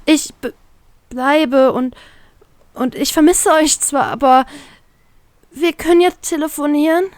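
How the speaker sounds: put-on high, hesitant girl's voice forcing a smile; holding back tears while smiling; pained smile. Girl saying goodbye to her crew, tearful smile. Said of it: "lächelt sie gequält".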